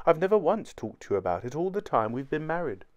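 confessing wife. A narrator's reading.

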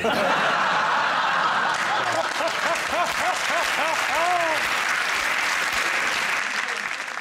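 squealing burst of laughter; high shrieking laugh. Real, not acted. Big laugh after joke.

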